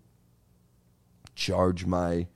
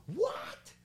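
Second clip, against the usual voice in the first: very high mock yell, distant and thin; imitating neighbor's scream; 12 dB quieter than usual. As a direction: squeak out the neighbor's late-night shout. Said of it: "he just screams".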